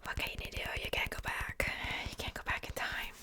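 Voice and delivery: ordinary talk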